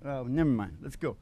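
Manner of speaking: normal talking voice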